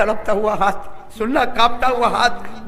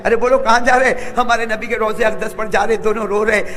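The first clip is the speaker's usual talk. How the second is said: ordinary talk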